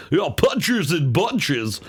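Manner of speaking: slow, drawn-out play-by-play announcer voice; douchebag announcer impression. Comedian mocking a boxing announcer's catchphrase, hammed-up announcer voice. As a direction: stretch it out like a cheesy boxing announcer. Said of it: "talk like a douchebag".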